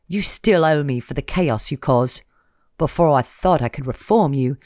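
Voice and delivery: loud cold reproach; menacing nobleman. Acted. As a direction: lay the blame on her. Villain reproaches Lena.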